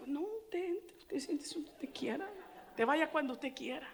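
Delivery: very soft, accommodating reply; hushed, imitating a placating answer; soft reassurance. Preacher reassuring mother she may sit anywhere, hushed and placating.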